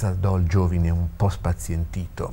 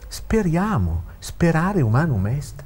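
usual speaking voice

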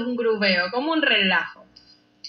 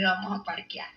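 softer, low-key take; quiet repeat; 3 semitones lower, 7 dB quieter than usual. Shown as a demonstration. Repeating the slang for viewers, softly. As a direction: say it quietly, almost to yourself. The same phrase, said again.

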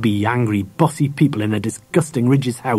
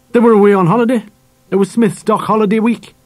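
raised, emphatic dad voice; father character; 9 semitones higher, 4 dB louder than usual. A narrator's reading. Dad answers Harry about the neighbours, emphatic. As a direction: explain it in a higher, insistent voice.

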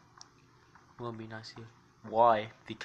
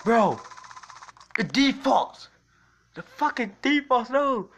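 much higher and louder, a raging outburst; frustrated yell; 12 semitones higher, 10 dB louder, slower than usual. Real, not acted. Streamer yelling at the game about default controls, real frustration. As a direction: scream at the broken controls.

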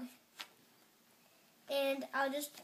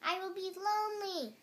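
shouted plea; 8 semitones higher, 3 dB louder than usual. A narrator's reading. Toad alone in the dark to Frog, high plaintive cry. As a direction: plead it high, like calling after someone. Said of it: "he shouted".

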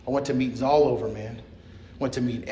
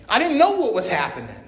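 normal talking voice

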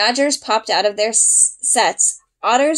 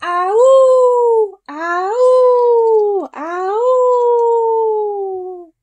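high, loud, drawn-out howls; wolf howl; 12 semitones higher, 4 dB louder, much slower than usual. Acted. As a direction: howl like a wolf pack waking up. Wolf pack howling.

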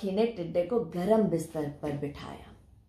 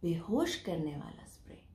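whisper; 3 semitones lower, 10 dB quieter than usual. Acted. Whispered aside in story.

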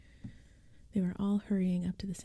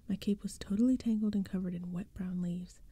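plain reading voice

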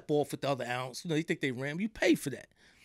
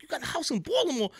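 surprised disbelief; 11 semitones higher, faster than usual. Retold or imitated. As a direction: pitch jumps way up on the question. Repeats friend's words in disbelief.

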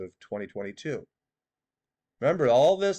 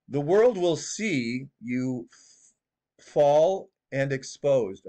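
mock prophet drawl; slower than usual. Mocking the prophetess to viewers, slow stretched doom voice. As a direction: drag every word out like a doom prophet. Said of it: "She says it like this".

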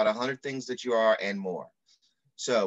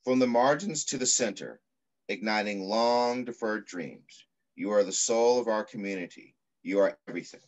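put-on reading to children. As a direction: read it like an affirming poem to children. Reads picture book aloud.

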